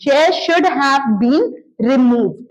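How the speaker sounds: slow and spaced; slow model sentence. Shown as a demonstration. Teacher dictating the passive sentence slowly. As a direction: drop each word in place. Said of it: "बस आप बोल रहे हैं".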